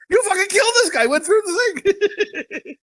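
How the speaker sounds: high, laughing mock yell, slowing down. Retelling a movie henchman yelling at his partner, laughing mock yell. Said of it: "The one guy's yelling at him like".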